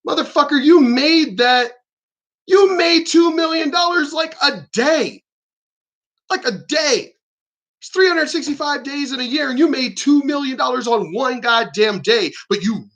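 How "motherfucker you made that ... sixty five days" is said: real angry shout. Real, not acted. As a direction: blast the rich hypocrites, slowing on the jab. Ranting furiously at rich celebrities.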